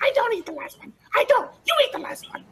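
very high, yelling hungry goblin; high goblin screech demanding to eat. Acted. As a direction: squeal it like a greedy little goblin. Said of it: "And he's like".

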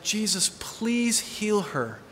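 soft, slow plea under the breath; hushed prayer. Retold or imitated. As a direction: pray it quietly and slowly, almost to yourself. Whispered prayer over blind woman.

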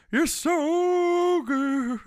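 very high, slow sexy croon. Retold or imitated. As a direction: croon it like a soulful singer. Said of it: "I'm just imagining [name]".

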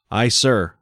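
usual speaking voice

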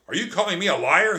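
high, loud, fast angry shout. Acted. Trap accused of lying, angry shout. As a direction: snap back offended and loud. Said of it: "he shouted".

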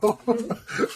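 high-pitched giggle; high laugh. Real, not acted.

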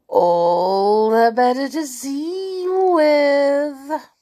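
loud, slow, rising menace; sinister wolf. Acted. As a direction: stretch it out like a scheming wolf. Wolf replies again.

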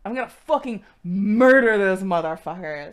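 loud mock war cry; hammed-up bloodthirsty warrior; over-the-top menace. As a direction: roar it, stretching the threat way out. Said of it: "you went too far".

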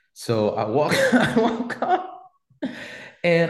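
laughing through the words; cracking up mid-story. Real, not acted. Laughing as he tells the podcast host.

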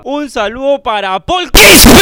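stretched-out shout-out building to a yell; hyped shout-out. Acted. Streamer greeting a donor, drawn out into a shout. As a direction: draw it out, then belt the surname.

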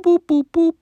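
high little robot boops; toy robot voice. Real, not acted. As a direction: boop like a tiny toy robot. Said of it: "when I do the little boop".

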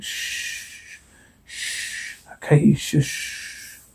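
breathy shushing, barely voiced; soothing whispered shush; hushing whisper. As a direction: whisper to calm the baby. Hushing a crying baby at night.

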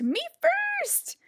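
very high excited shriek. A narrator's reading. Pinkerton shrieking while galloping. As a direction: squeal it at full gallop. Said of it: "Pinkerton shrieked".

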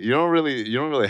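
usual speaking voice

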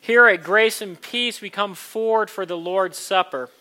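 loud, earnest preaching voice; kindly vicar sermon. Acted. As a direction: preach it warmly to the room. Communion invitation, preached loudly.

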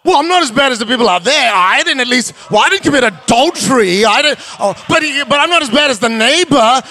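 hammed-up excuse-making believer; imitating Christians excusing themselves, mildly mocking. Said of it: "That's what a lot of Christians are like".

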